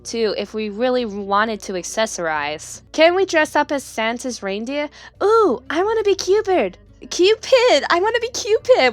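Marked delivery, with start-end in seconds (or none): 5.2-8.9 giddy squeal. Acted. Player reading Cherry's reindeer wish, high giddy squeal. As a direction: squeal it like an excited child.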